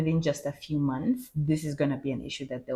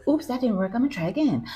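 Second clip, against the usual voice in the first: higher, bright mock chatbot chirp; chirpy AI voice; 8 semitones higher than usual. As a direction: play the eager chatbot, pitched up. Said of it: "it's actually trying, like".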